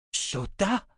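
high, rising surprised cry of a name; delighted exclamation. A narrator's reading. Richard recognising the witch, delighted surprise. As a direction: exclaim with a smile, high-pitched. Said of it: "s'écria Richard avec un grand sourire".

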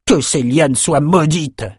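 cursing soldier. Acted. Soldier hacking at lianas, mildly cursing. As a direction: grumble a curse, dragging the words.

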